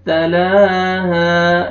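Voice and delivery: तलाहा with a light, thin ता, very slow; तलाहा, ता बारीक, लाम stretched. Shown as a demonstration. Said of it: "ता को बारीक करके पढ़ेंगे". (तलाहा में ता बारीक, धीरे)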